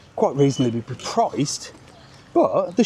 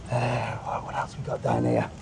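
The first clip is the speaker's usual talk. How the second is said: whispered to himself; hushed, under the breath; 5 semitones lower, 8 dB quieter than usual. Real, not acted. Muttering to himself before saying it aloud, whispered.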